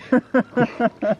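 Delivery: high burst of laughter; quick high chuckle. Real, not acted. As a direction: laugh at your own joke. Laughing at the ten ten.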